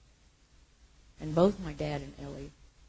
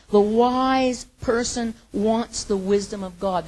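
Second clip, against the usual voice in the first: emphatic preaching; 4 semitones higher, 10 dB louder, slower than usual. Acted.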